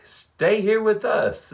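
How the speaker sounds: slow, high, sing-song invitation; mock-sweet taunting tagline; teasing sign. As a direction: rub it in like a smug advert. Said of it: "there's a little sign like".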